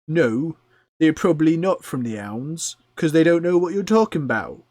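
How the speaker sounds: loud, high goblin voice; exasperated goblin. Acted. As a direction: put a drunken mate straight as a goblin. Second goblin corrects the first.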